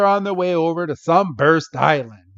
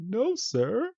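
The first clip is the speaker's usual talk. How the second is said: put-on high, soft little girl reply; mock little girl voice, much higher and softer; meek little girl; 9 semitones higher, 9 dB quieter than usual. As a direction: squeak it out timidly.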